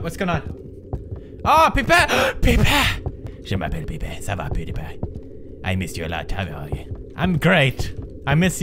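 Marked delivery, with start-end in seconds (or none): 1.4-3.0 high, gasping excitement; high-pitched, breathless glee; delighted outburst. Real, not acted. Excited greeting of a found pipe. 3.4-7.1 low, soft voice; deep, hushed playful sweet talk; mock tender reunion. Plays tender reunion with a pipe.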